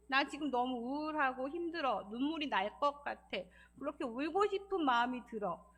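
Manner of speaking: normal talking voice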